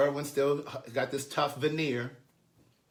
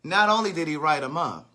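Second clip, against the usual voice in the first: ordinary talk, nothing marked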